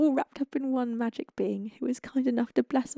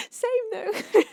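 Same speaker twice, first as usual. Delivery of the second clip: laughing; 12 semitones higher, 5 dB louder than usual. Real, not acted. Laughing at chat's joke.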